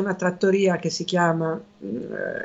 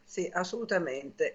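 ordinary delivery

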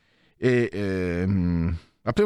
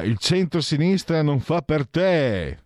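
higher, louder and slow, like a put-down; mocking quote; 10 semitones higher, 4 dB louder, slower than usual. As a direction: throw the line back as a jab. (citazione beffarda, più alta, più forte e lenta)

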